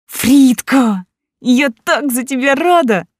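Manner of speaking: high, loud and bursting with joy; delighted. Acted. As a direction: gush with delight for a friend. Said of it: "восторженно проговорила Далия".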